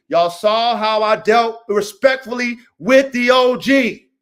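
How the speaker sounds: slow, loud stress on "respectfully"; emphatic. Real, not acted. Coach reminding viewers how he treated the OG, emphatic.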